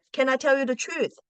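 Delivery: normal talking voice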